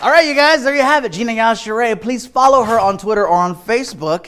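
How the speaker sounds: loud, high announcer outro; hyped show host. Acted. Host thanking the comedian, big announcer voice. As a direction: sell the wrap-up to the crowd.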